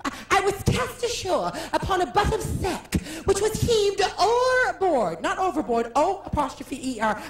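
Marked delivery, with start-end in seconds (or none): 0.1-5.2 hammed-up Shakespeare line; Shakespearean delivery. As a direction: boom it like a stage actor. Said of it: "I had a line like".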